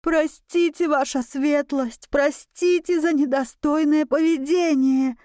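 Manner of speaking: high, trembling-lipped begging; whimpering plea. A narrator's reading. Tutor begging the duke for his life, whimpering. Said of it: "шептал он трясущимися губами".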